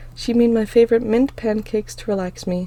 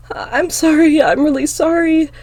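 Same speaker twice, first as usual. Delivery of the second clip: high, loud tearful apology; sniffling apology; 8 semitones higher, 9 dB louder than usual. A narrator's reading. Girl apologizing to her sister through tears, sniffling. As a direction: apologize while holding back tears. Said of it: "I say, sniffling".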